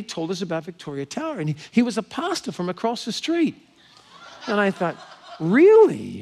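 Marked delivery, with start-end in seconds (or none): 5.4-6.2 loud, higher burst of surprise; astonished. Retold or imitated. Woman hearing a pastor met her seatmates, astonished. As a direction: react as if stunned by the coincidence.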